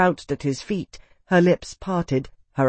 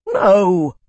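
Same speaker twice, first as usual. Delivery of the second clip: loud, higher, outraged outburst; sputtered protest; 4 semitones higher, 6 dB louder than usual. A narrator's reading. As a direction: burst out in protest. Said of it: "sputtered Calyx".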